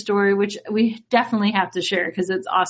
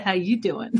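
low, softer catchphrase impression; Enzo; 5 semitones lower, 5 dB quieter than usual. Woman quoting a wrestler to her work friends, imitated voice. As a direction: drop low for a wrestler's greeting. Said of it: "in my Enzo voice".

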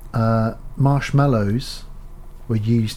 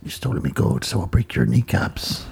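Liam Neeson leprechaun; 2 semitones lower than usual. Retold or imitated. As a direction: menace like a hard-man leprechaun. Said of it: "Imagine Liam Neeson voicing the leprechaun".

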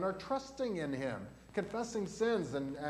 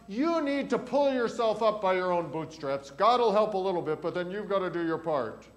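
false teacher; 3 semitones higher, 6 dB louder than usual. Acted. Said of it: "someone comes to you and says something else".

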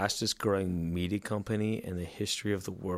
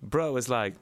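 ordinary talk

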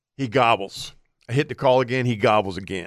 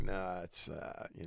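whispered brush-off; 5 semitones lower, 18 dB quieter than usual. Retold or imitated. Brushing off wife's question.

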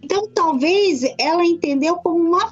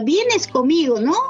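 "não" pitched up as a tag question; question tag intonation. Shown as a demonstration. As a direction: lift the final "não" into a question. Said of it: "Porque aqui a gente fala".

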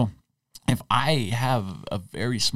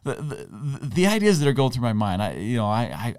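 ordinary delivery

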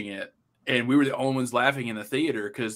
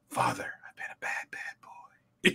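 hushed, voiceless confession; whisper; 8 dB quieter than usual. Retold or imitated. Monk whispering to another monk in a spoof trailer, whispered. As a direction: whisper it like a guilty secret. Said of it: "the last thing you hear is someone whisper".

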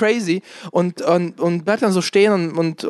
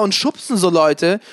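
usual speaking voice

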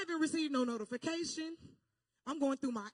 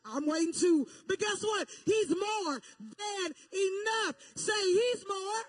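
full-force preaching; 6 semitones higher, 6 dB louder than usual. Real, not acted. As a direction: drive it home to the congregation, building to a shout. Preaches to the congregation.

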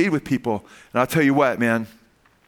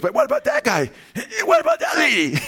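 put-on very high, squeaky pestering kid; little boy's scratchy voice. Preacher acts out child pointing out strangers, squeaky scratchy voice. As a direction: imitate a child piping up with questions. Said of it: "in his little scratchy voice".

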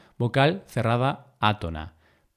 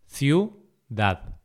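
ciudad split into ciu, dad; syllable by syllable. Shown as a demonstration. Teacher dividing ciudad for learners, syllable by syllable. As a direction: say "ciudad" with a pause between syllables.

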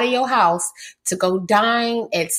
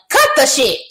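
sharp, higher exasperated snap; 6 semitones higher, 5 dB louder than usual. Real, not acted. Podcast host fed up with men's excuses, exasperated snap. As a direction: cut it off hard, fed up.

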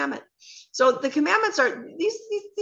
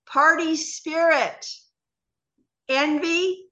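each vice set apart, slow and higher; read more slowly; 4 semitones higher, slower than usual. Shown as a demonstration. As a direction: lay out the list one word at a time with long pauses. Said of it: "I'll read them more slowly this time".

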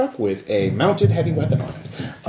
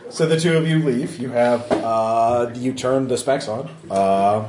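ordinary talk

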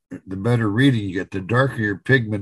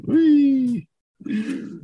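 much higher, gleeful wee; drunk pilot whee; 14 semitones higher than usual. Pretend. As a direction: squeal like a drunk pilot joyriding. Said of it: "it's like".

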